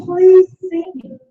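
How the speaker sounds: slow, high plea to be seen; child's plea. Retold or imitated. Mimicking a child begging her mum to notice her. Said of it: "She's saying".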